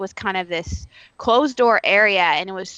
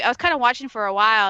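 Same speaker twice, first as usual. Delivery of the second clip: usual speaking voice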